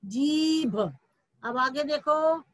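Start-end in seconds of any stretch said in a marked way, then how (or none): 0.0-0.8 जीभ with a long, held ee; long vowel. Shown as a demonstration. Teacher answering which word was made, drawn-out जीभ. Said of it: "क्या शब्द बना था?".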